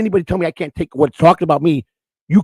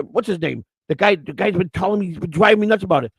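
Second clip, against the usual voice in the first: ordinary talk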